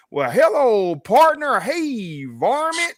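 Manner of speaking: high, loud hammed-up cowboy drawl; mock Texan drawl. Mocking how TV shows make Texans talk, hammed-up cowboy drawl. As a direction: ham up a TV Texas cowboy, way up high. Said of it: "just this long drawl".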